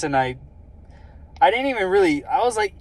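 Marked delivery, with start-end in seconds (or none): none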